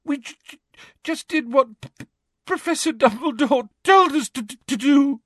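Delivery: high, tearful stutter; hammed-up sobbing excuse; sniffling stammer. A narrator's reading. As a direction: trip over consonants, then wail. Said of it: "sniffing loudly".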